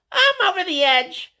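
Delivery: very high, slow squeaky retort; mock the birthday girl shrilly; high squeaky mock woman. Birthday girl's reply.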